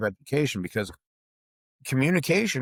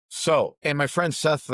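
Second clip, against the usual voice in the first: normal talking voice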